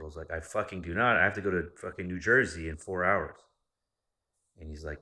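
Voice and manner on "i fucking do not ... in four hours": loud, exasperated refusal; mock-furious loud protest; angry retort. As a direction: snap back at him, fed up.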